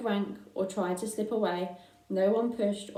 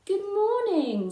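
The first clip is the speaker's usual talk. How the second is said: much higher and slow, a small polite pig; 12 semitones higher, 5 dB louder, slower than usual. Acted. Babe the pig greets the sheep, high and polite. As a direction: sweetly greet the flock as little Babe.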